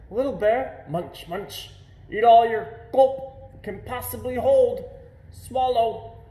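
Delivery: hammed-up mother bear talking between mouthfuls. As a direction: urge the cub on, chomping noisily. Mother bear urges cub to eat.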